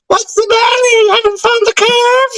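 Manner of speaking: mock cartoon voice. Friend imitates the cartoon voice mocking globe debaters. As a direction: squeak the question to mock them. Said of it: "just be like".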